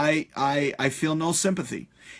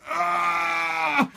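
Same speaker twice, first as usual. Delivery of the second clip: mock yelp of dismay; 12 semitones higher than usual. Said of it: "I'm like".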